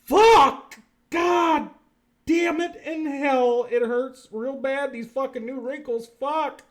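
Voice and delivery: high, slow cries of comic pain; mock agonized outburst. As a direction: act out a painful overload, loudly at first. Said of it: "and you're going".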